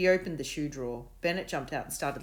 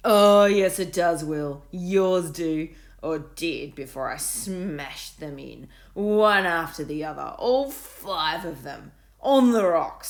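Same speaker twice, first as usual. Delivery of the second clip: gloating bully; 2 semitones higher, 12 dB louder, slower than usual. A narrator's reading. Bully gloating over smashed wibblers, loud. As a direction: savour each word.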